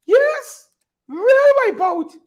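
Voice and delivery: hammed-up high gloating voice; mock high gloat. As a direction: crow it up high like a gloating winner. Mocking a gloating politician.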